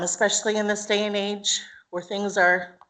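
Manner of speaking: ordinary delivery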